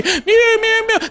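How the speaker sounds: very high squeaky meows; little boy playing the cat, high pitched; kid meowing. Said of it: "I said".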